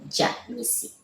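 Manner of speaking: Jamisi, high and whispered; name whispered high. Shown as a demonstration. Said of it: "That's the meaning".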